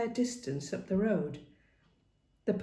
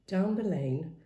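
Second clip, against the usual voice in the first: plain reading voice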